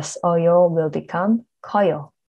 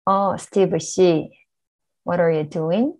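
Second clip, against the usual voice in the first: normal talking voice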